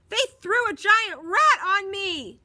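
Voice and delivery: high, loud, slow tearful wail; near tears. A narrator's reading. Bully Marty wails near tears to the driver. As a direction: whimper out a complaint. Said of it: "He was almost in tears".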